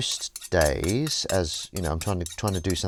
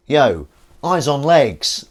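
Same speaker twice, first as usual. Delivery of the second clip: loud, slow, higher call; drawn-out greeting; 7 semitones higher, 12 dB louder, much slower than usual. Acted. Hailing the viewer eyes on legs, loud and drawn out.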